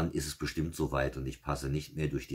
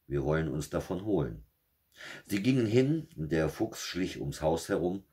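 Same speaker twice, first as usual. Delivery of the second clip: steady fairy-tale reading voice; story narration; 2 semitones higher, 4 dB louder than usual. A narrator's reading. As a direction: read it like a bedtime fairy tale. Märchen vorgelesen. (Märchen vorlesen, ruhig erzählt)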